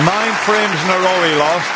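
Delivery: loud, high, rising on "frames"; excited. Real, not acted. Commentator repeats the streak, fired up.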